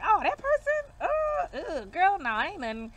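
loud, high-pitched theatrical rejection; mock disgusted. Reader acting out a viewer rejecting an unlikely partner, mock disgusted. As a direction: recoil from the very idea, hammed-up.

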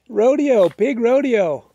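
loud, drawn-out holler; calling out. Real, not acted. Announcing the pig rodeo, called out loud. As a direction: call it out loud, stretching the words.

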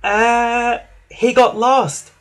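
high, hesitant engine voice; stall, then answer in a high, drawn-out voice; Emily. A narrator's reading. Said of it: "wheezed Emily".

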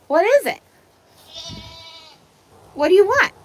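high, loud calling out what; loud repeated what. Real, not acted. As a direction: call back loudly, a little exasperated. Answering goat's repeated bleats.

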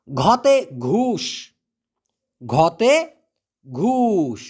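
very slow, stretched letter word; slow teaching drawl; drawn out. Shown as a demonstration. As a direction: stretch each syllable out for the class. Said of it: "teacher drawing out the letter word".

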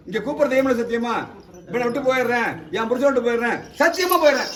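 loud, insistent oath, phrase by phrase; forceful oath-leading. Real, not acted. Exorcist making the spirit swear to leave, forceful dictation.

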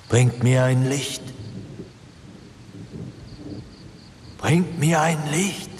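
high, slow, eerie ghostly call; a voice echoing through the whole house; booming ghost voice. A narrator's reading. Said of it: "dröhnte die geheimnisvolle Stimme".

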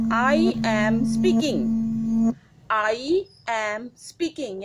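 slow, stressing I; teacher demonstrating first person; slow example read. Shown as a demonstration. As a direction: lean on I, word by word. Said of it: "जैसे अभी कौन बोल रहा है?".

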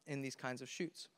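usual speaking voice

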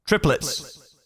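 word repeating in quick echoes; triplet echo effect. Shown as a demonstration. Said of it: "echo will sound like this".

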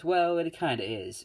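lightly voiced blunt Bakugo, close to the speaker's own voice; Bakugo's blunt line. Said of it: "Bakugo is the one who goes".

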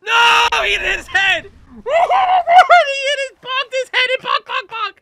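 very high, loud cries; hysterical screaming. Real, not acted. Player watches climber bonk his head, hysterical shrieking. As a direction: shriek in dismay as it tumbles.

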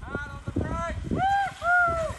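excited shout. Real, not acted. Spotter calling then cheering.

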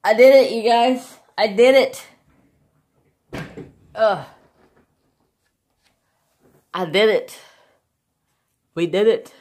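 loud, high victory shouts; triumphant cheer. Real, not acted. Crafter telling viewers the markers are finished, triumphant cheering. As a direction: shout it out in celebration.

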